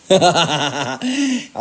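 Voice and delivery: amused real laugh. Real, not acted. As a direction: laugh at your own joke. Laughs at his own comeback. (tertawa geli)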